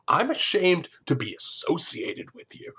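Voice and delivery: high-pitched mock indignation; amped-up criticism. Host imitates the man criticizing Claire, amped-up mock indignation. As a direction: escalate into hammed-up disapproval. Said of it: "really amped up the criticism quickly".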